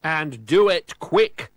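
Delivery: loud, slow threat; warning. A narrator's reading. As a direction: lean on every word as a warning. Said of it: "warned McSneak".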